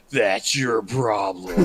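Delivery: slow, punchy trailer announcer; movie trailer voice. Pretend. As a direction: drag it out like a trailer voice. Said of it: "movie trailer guy voices".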